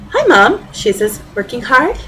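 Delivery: cheeky daughter checking in; teasing daughter. Acted. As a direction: needle mom with a bright teenage greeting. Teen daughter teasing her mom.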